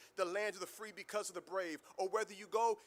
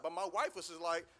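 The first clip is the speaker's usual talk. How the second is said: ordinary delivery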